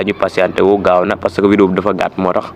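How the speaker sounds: ordinary talk, nothing marked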